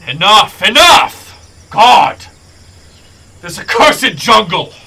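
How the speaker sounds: loud, high, exasperated roar; furious shout. Acted. Breakbone gives in to Undril, bellowing loud and furious. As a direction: rage at the jungle itself.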